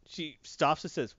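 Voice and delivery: normal talking voice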